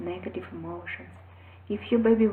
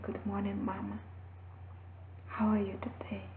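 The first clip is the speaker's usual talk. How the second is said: soft morning greeting; 11 dB quieter than usual. Retold or imitated. Happy son greets mother morning.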